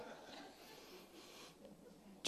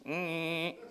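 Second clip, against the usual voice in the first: higher, soft steady "mmm" hum; imitated car hum; 6 semitones higher, 7 dB quieter than usual. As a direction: mimic a feeble engine with a hum. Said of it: "not supposed to sound like this".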